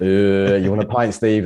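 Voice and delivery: mock his mates teasing him over a pint; mocking mates' voice. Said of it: "it's just endless mockery".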